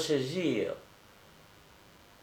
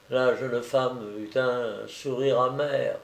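ordinary delivery, nothing marked

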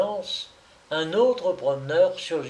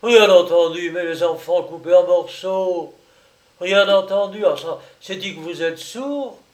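loud, higher outraged retort; furious disbelief; 4 semitones higher, 8 dB louder than usual. Acted. The fat man leaps at the narrator in outraged disbelief, furious. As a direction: throw the words back at him.

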